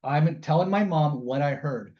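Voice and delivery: ordinary talk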